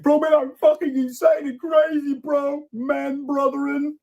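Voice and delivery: very high, squeaky tough-guy parody; mocking Bobby. Mocking Bobby's fake-insane video in a squeaky falsetto. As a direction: play a fake-crazy meathead in a silly falsetto. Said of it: "Being like".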